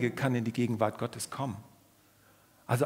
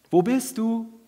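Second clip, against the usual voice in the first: higher, louder calling out; 8 semitones higher, 5 dB louder than usual. Shown as a demonstration. God calling out to Adam in the garden, louder. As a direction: call as if searching for someone. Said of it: "Was für ein trauriger Satz".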